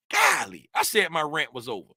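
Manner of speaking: ordinary delivery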